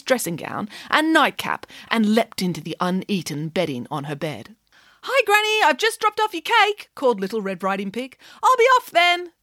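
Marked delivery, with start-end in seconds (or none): 5.0-9.3 piglet voice. Acted. Pig called to granny.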